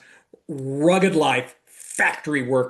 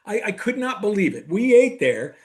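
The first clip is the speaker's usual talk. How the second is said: normal talking voice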